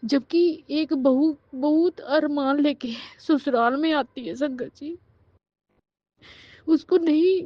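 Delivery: high, anguished pleading voice; real tearful protest. Real, not acted. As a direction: plead on behalf of every wronged bride. Tearful plea for daughters-in-law. (रुआँसी, ऊँची आवाज़ में गुहार)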